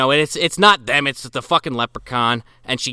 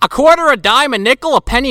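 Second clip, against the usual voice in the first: normal talking voice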